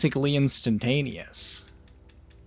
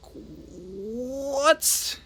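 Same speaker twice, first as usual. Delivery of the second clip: usual speaking voice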